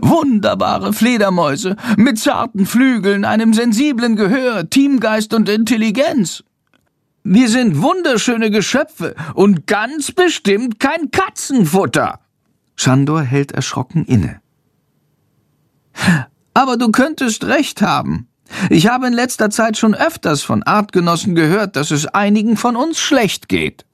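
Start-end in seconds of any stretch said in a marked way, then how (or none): 0.0-12.2 much higher bat voice, only slightly raised, slowing at the end; mildly raised bat voice. Acted. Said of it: "mit erhobener Stimme". 16.5-23.9 much higher cartoon bat voice, outraged; a small bat huffing in disbelief; indignant little bat. Narrator voices the bat Chandor.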